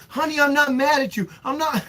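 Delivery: put-on high-pitched call to a crying child; pleading reassurance. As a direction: act out pleading with your daughter from the toilet. Calling reassurance to crying daughter.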